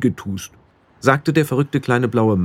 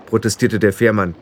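ordinary delivery, nothing marked

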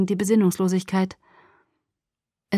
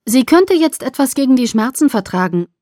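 ordinary delivery

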